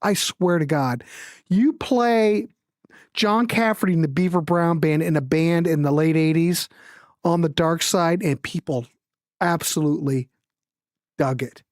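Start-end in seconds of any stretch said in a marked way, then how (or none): none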